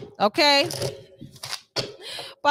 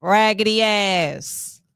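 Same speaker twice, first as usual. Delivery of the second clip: sassy brush-off; 7 semitones higher than usual. Retold or imitated. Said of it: "You like".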